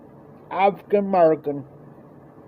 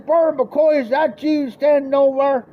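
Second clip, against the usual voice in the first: higher, raised call; 9 semitones higher than usual. Retold or imitated. Dad calling out to Burley across the yard, raised high call. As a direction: keep hailing him from across the street.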